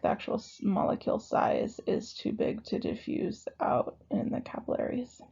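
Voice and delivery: whispery, voice cutting out; voice giving out. Real, not acted. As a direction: let the voice fade to a whisper. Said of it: "I'm losing my voice".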